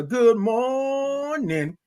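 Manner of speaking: high, long-held MORNING greeting; drawn-out morning. Real, not acted. Host greeting viewers by name, morning drawn out. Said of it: "love all those O's and R's".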